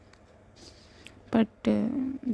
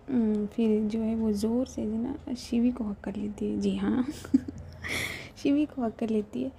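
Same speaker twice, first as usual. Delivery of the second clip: ordinary delivery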